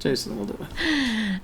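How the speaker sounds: high voice breaking into laughter; laughing. Real, not acted. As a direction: say it while cracking up. Repeats it, breaking up.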